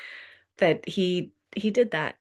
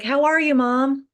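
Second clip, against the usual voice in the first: a hint of a teen's bright call back, higher and a bit louder; lightly voiced son; 7 semitones higher, 3 dB louder than usual. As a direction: answer your mom brightly from the stairs. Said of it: "He's like".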